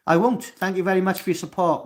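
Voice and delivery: eager thanks to a supportive viewer. Real, not acted. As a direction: brighten up and thank them loud.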